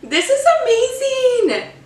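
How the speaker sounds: high, laughing through the words; laughing delight. Real, not acted. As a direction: gush while laughing. Delighted by the drink.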